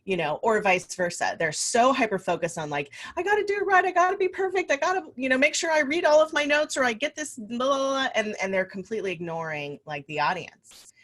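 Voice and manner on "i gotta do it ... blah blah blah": high, fretful mock of a nervous new speaker; anxious beginner. Coach mocks beginner speakers obsessing over notes, high fretful voice. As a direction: squeak out the worries of a perfectionist newbie.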